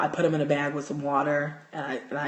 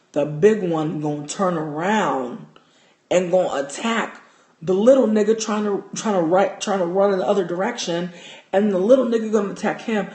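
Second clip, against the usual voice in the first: much louder, worked-up telling; hyped storytelling; 8 dB louder than usual. Retold or imitated. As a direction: tell it loud and breathless with excitement. Recounting mice fighting.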